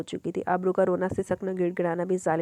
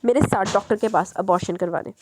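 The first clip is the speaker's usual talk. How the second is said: ordinary delivery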